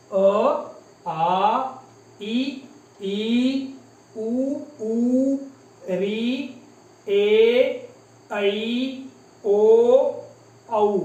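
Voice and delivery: अ, आ, इ, ई one at a time, held and separated; vowel recitation. Shown as a demonstration. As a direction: recite each vowel sustained, ऐ as 'a-i', औ as 'a-u'. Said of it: "एक बार फिर से हम दोहरा लेते".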